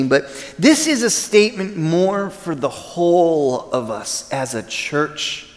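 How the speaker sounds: usual speaking voice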